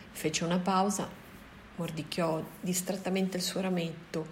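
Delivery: ordinary delivery, nothing marked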